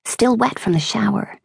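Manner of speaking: ordinary delivery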